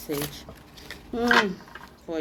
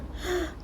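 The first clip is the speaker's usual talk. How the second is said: gasp; 14 semitones higher, 6 dB quieter than usual. Real, not acted. Reader shocked by the card.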